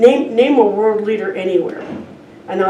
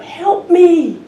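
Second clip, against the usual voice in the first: high frantic cry for help, loud; a scared little boy yelling into the canyon; frightened scream; 9 semitones higher, 6 dB louder than usual. Retold or imitated. Little boy lost at a canyon edge, screaming for help. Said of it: "screamed out".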